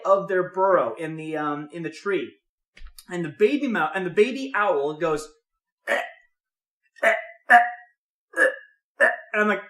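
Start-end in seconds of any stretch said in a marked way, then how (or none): 5.9-9.1 short, spaced hacking "eh" sounds; imitated dry hacking. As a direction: imitate coughing up a hairball. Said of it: "is he gonna cough up a hairball".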